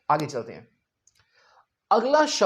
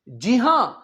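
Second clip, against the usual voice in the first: ordinary talk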